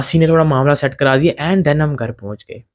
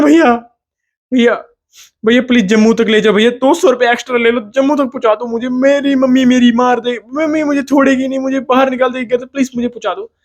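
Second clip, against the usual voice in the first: desperate pleading; 7 semitones higher than usual. Retold or imitated. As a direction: plead like your life depends on it. Begging auto driver for ride.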